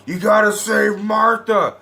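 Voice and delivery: high, drawn-out mock plea; Superman. Reviewer imitates Superman about to be stabbed, mock desperate plea. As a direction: plead slowly in a strained put-on voice. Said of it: "He says".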